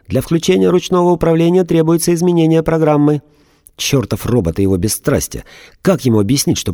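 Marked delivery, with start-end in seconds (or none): 0.1-3.2 higher, detached machine voice; a robot reciting its program; emotionless robot. A narrator's reading. Robot answers Viktor.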